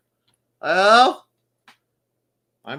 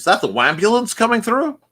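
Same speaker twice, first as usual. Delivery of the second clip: mock taunt; 10 semitones higher, 10 dB louder than usual. Teasing whiner in chat.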